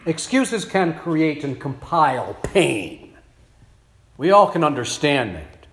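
normal talking voice

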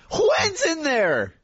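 high, drawn-out disbelief; astonished. Real, not acted. Host finding another word in the dictionary, astonished. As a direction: stretch it slowly upward in amazement.

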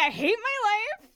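loud, high hammed-up wail; mock despair. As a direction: play the tragedy queen, loudly. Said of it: "I was so upset".

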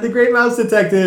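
high laughing cheer; laughing shout. Real, not acted.

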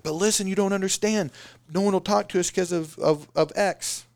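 high, strained mock pleading; pleading founders. Kidnappers pitching a product to the investor, high mock pleading.

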